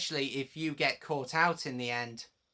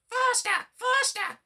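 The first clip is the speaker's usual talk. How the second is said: very high, louder mischievous truck chant; grumbling trucks; 8 dB louder than usual. A narrator's reading. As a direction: urge each other on downhill. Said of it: "they grumbled".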